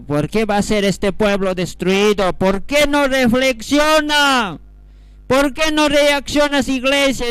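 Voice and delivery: high, loud pleading wail; prophet's anguished cry. Acted. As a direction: cry out in desperate appeal to the people. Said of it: "Lloraba, hermanos, el siervo, lloraba".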